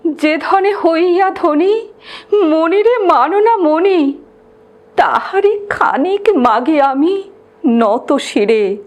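high, understated tearful plea. A narrator's reading. Brahmin at the saint's feet begging for true wealth, mildly tearful plea. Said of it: "কহে অশ্রু জলে".

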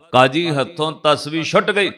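usual speaking voice

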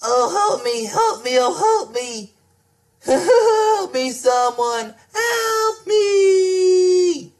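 high, wavering pleas for help; fluttering cries for help. Acted. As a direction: whimper and cry out, begging. Connor crying out, captured.

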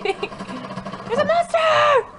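very high, drawn-out squeal of alarm; startled shriek. Real, not acted. Player alerting friend to a monster in the game, startled shriek. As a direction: shriek it high, stretching the end.

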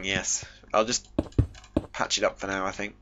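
ordinary talk

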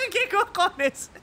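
high, slow, laughing through the words; laughing disbelief. Real, not acted. Streamer laughing in disbelief at the game. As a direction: ask it while cracking up.

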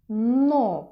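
Но said loud and clear; teacher modelling the syllable loudly; syllable emphasised. Shown as a demonstration. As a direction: call out Но as a clear single syllable. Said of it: "На який склад починається це слово?".

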